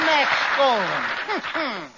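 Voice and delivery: drawn out very slowly in disbelief. Acted. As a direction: stretch the name out, stunned. Costello repeats incredulously, very slow.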